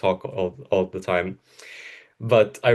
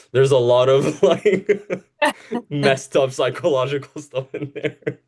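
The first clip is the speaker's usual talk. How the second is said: laughing through the words, a little higher; 5 semitones higher, 4 dB louder than usual. Real, not acted. Laughing as she owns up to her messed-up childhood plots. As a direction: giggle while admitting it. Recalling dark childhood stories.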